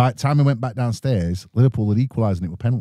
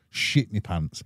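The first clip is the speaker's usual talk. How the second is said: normal talking voice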